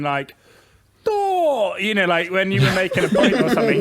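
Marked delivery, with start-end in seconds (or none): 1.1-1.8 high drawn-out mocking drone; Andy. Friend mocking people over a point, high mocking drawl. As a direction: stretch it out high, mocking someone. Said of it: "mock people by going".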